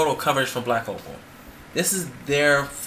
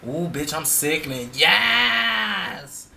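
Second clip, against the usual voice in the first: imitating YouTube girls; 3 semitones higher, much slower than usual. Mocking the girls' YouTube videos, shouted diva squeal. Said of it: "they be like".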